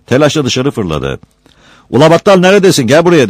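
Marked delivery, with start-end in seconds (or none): 1.9-3.3 loud, high-pitched summons; shouting. A narrator's reading. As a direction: call someone over from afar. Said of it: "diye bağırdı". (yüksek, tiz sesle bağırarak çağırma)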